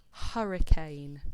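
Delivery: hurricane read slow and loud; word read slowly. Shown as a demonstration. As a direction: stretch out hurricane for the class. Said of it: "here's our first word".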